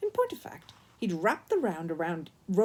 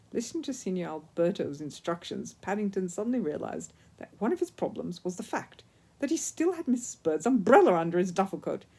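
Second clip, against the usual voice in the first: plain reading voice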